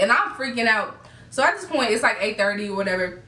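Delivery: ordinary talk, nothing marked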